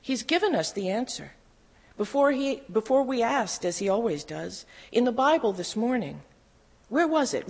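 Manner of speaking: high-pitched, eager delivery; excited. A narrator's reading. Sister realizing the Bible holds the answer, excited. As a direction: let the pitch leap with each discovery. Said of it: "she said excitedly".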